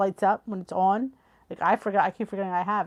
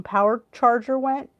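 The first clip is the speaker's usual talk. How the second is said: usual speaking voice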